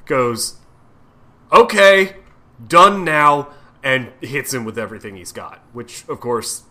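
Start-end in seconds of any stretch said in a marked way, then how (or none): 1.5-3.5 mock fed-up snap. Peter finally loses patience.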